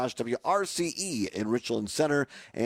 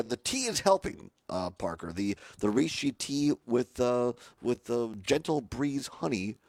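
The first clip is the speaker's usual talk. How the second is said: ordinary delivery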